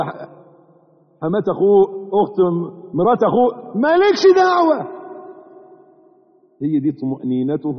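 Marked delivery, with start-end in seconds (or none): 3.9-4.8 high, loud stern warning; sharp warning. Acted. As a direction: cut him off firmly. Preacher warning emphatically.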